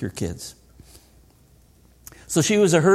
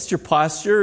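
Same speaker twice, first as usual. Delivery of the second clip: ordinary talk, nothing marked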